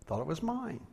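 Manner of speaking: meek excuse. Retold or imitated. Preacher retelling his excuse to an angry customer, meek. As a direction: offer the excuse in a small soft voice.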